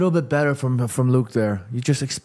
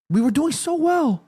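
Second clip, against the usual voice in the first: plaintive lament; 8 semitones higher than usual. Real, not acted. Lamenting team's lost lead.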